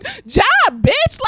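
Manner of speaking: high, sharp mock-indignant comeback; indignant retort. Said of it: "calm, 'cause I was like".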